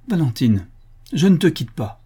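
high, urgent cry to a friend; exclaimed. A narrator's reading. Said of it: "s'écria Florence en s'élançant vers son amie". (cri du cœur, aigu et fort)

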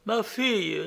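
a hint of a sorrowful ghost mother; ghostly mother. A narrator's reading. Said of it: "lui dit-elle".

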